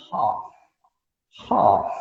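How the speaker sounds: "half" in a British accent with a long open A; plummy British "half". Shown as a demonstration. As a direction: say "half" the pompous British way, "haaf". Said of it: "aquela pomposidade britânica".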